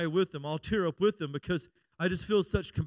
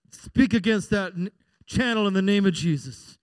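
mock rebuke; 2 semitones higher, 8 dB louder than usual.

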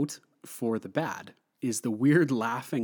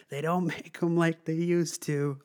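higher mocking Joker quip; Joker taunting; 7 semitones higher than usual. Reviewer quoting Joker taunting the heroes, gleeful higher voice. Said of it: "he's like".

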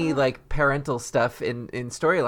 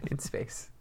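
whispered; 14 dB quieter than usual. Acted. Echoing spooky tagline.

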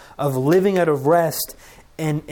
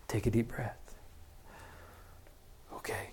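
gentle divine reassurance; 5 semitones lower, 16 dB quieter than usual. Retold or imitated. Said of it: "And then God says".

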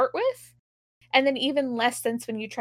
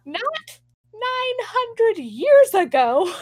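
mock outrage; 10 semitones higher, 5 dB louder, slower than usual. Real, not acted. Mocking the timeline.

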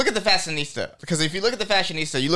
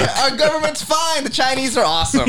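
high-pitched, over-agreeable praise; mock reassurance; 8 semitones higher than usual. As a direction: pipe up high, pretending all is fine. Mock placating of the censors.

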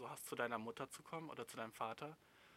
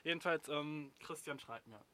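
ordinary talk

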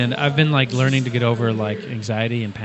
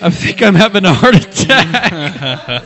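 louder, slow, laughing through it; half-laugh a frantic claim; laughing mock panic; 5 semitones higher, 8 dB louder, slower than usual. Panicked son telling the old man his fear, loud and laughing.

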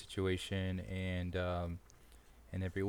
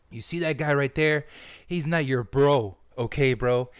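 higher, louder, barking put-on officer; mock drill officer; 5 semitones higher, 6 dB louder than usual. Officer scolds the recruit for calling the chief bro, barking. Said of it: "Sir, yes, sir".

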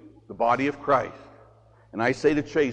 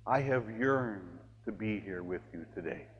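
tender voice of Jesus; 9 semitones lower, 14 dB quieter than usual. Acted. Priest voicing Jesus softly to the congregation, tender and hushed. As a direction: gently voice Jesus yearning for his people.

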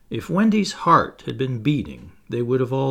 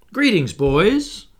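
high, loud, drawn-out hail; slow, ringing greeting; cried greeting; 7 semitones higher, 6 dB louder, slower than usual. A narrator's reading. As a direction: call out a grand hello. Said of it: "he cried".

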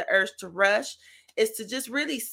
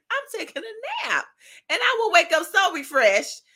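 high, whiny put-on voice; mock whine; 10 semitones higher, 3 dB louder than usual. Joking that when mad she naps, mock whine. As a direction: whine it like a sulky kid.

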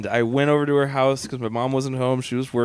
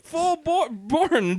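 ordinary talk

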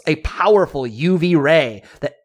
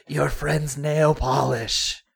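crone voice; 5 dB quieter, much slower than usual. Retold or imitated. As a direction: croak it slowly like an old crone. Old crone voiced in story.

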